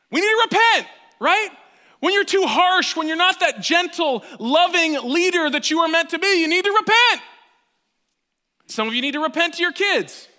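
a loud, high sermon cry; full-force preaching. Acted. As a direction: preach it hard to the room.

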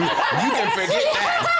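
high squeal dissolving into laughter; laughing refusal. Retold or imitated. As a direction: cackle out the refusal. Mock friend's refusal with laughter.

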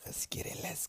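softly whispered "Let's get it". Shown as a demonstration. Repeated hype phrase, whispered.

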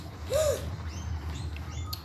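shocked gasp. Real, not acted. Shocked in game.

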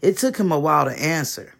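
usual speaking voice

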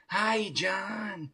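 a hint of a high sly greeting; lightly voiced Chucky. Doll greets the voodoo man, lightly voiced high greeting. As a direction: greet him slyly as the doll. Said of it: "It's like".

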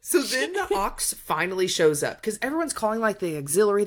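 ordinary talk, nothing marked

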